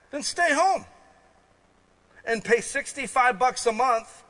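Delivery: high, loud preacher's push; rising fervent sermon voice; preaching at full force. Acted. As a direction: drive it home from the pulpit.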